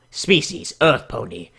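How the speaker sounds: ordinary delivery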